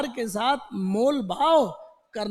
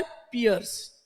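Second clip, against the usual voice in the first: pierce drawn out slowly; slow emphatic word; 4 semitones lower, much slower than usual. Shown as a demonstration. Teacher stresses piers slowly as a memory hook. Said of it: "pin से इसको याद करो".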